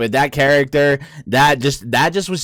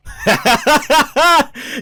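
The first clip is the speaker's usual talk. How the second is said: high burst of laughter; laughing; 11 semitones higher than usual. Real, not acted. Amused by villain in clip.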